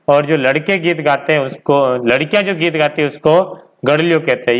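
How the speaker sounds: usual speaking voice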